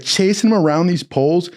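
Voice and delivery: ordinary delivery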